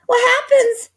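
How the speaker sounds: very high, very loud frightened gasp; panicked old wife. A narrator's reading. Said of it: "gasped Missus Twit".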